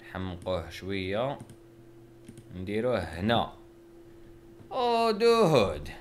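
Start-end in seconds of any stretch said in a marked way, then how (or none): 4.7-5.9 excited squeal. Real, not acted. Excited squeal at his drawing.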